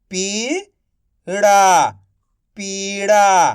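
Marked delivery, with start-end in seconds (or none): 0.1-1.9 slow stretched reading. Shown as a demonstration. Teacher to pupils, slowly stretched. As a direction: draw पीड़ा out slowly. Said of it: "आप इस तरीके से".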